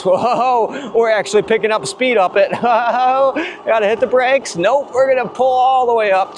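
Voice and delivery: high, excited whoops while laughing; thrilled and laughing. Real, not acted. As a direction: laugh and shout with real thrill. Racing uphill, laughing, thrilled.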